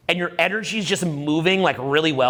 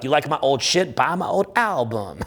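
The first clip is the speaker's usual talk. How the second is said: quoting [name]; 4 semitones lower than usual. Retold or imitated. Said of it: "[name] had a quote".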